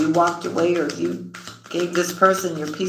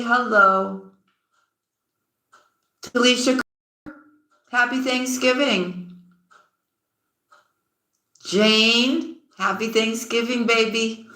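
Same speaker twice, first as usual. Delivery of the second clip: higher, bright sing-song greetings to each viewer; cheerful shoutouts; 6 semitones higher than usual. Real, not acted. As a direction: call out each name like welcoming friends.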